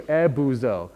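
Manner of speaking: "ebuzo" with a clear, full oo in the middle. Shown as a demonstration. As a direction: say "ebuzo" carefully as eh-boo-zo. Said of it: "very clear".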